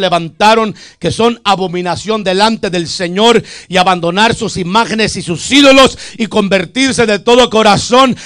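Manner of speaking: loud fiery sermon, rising to a call; preaching at full force. Acted. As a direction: preach it hard, demanding a glory to God. Preaching climax to congregation.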